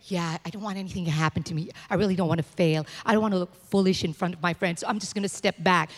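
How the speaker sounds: soft, quick, fearful little inner voice; timid self-preservation. Retold or imitated. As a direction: shrink back as the scared inner self. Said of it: "And self-preservation will say".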